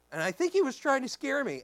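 normal talking voice

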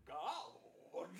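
God stretched out into three syllables, solemn preacher style; mocking lofty preachers who say God in three syllables. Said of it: "those guys that say God in three syllables".

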